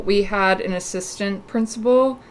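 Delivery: ordinary talk, nothing marked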